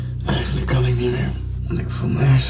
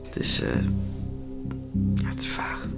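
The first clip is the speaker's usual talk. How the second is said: exhausted whisper; 10 dB quieter than usual. Real, not acted. Exhausted after staying awake.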